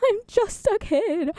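high-pitched, laughing and slow. Real, not acted. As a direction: giggle through it playfully.

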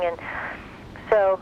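normal talking voice

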